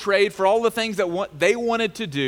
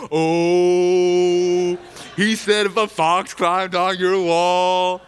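louder, slow, hooting crowd; hyped crowd jeer; 5 dB louder, much slower than usual. Acted. The audience cheers Tobiah's insult, loud hooting jeer. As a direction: whoop like a crowd egging on a roast. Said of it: "everybody's like".

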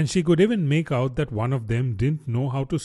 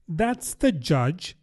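put-on slow, higher young girl voice; a girl savouring what she knows; lightly voiced young Alice; Alice to herself; 5 semitones higher, much slower than usual.

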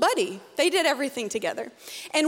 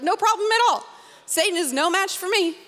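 mock boast; 6 semitones higher, 3 dB louder than usual. Mocking overconfident boast.

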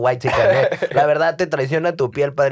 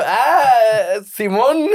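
respuesta nerviosa; 6 semitones higher, 4 dB louder, much slower than usual. Retold or imitated. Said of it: "Y yo".

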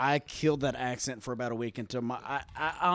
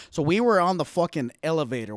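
louder put-on Boston accent; 2 semitones higher, 6 dB louder than usual. Shown as a demonstration. Friend showing off his old Boston accent, loud Boston. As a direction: launch the story in hammed-up Boston. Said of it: "Boston, there it is".

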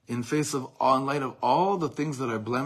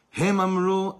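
loud recital; 8 semitones higher, 8 dB louder than usual. Acted. Reciting quoted poem in Hebrew.

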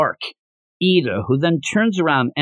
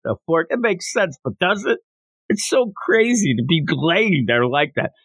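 usual speaking voice

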